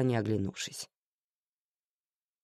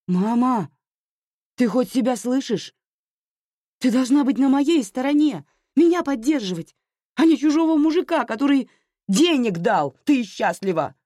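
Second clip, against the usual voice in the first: very high, loud, hurt outburst; desperate daughter yelling at her mother; shouting; 11 semitones higher, 7 dB louder than usual. A narrator's reading. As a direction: cry out for mother to take your side. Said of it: "кричала Аня".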